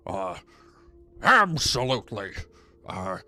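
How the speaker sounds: Nixon impression. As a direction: do Nixon with the jowls. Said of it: "I went Nixon with it".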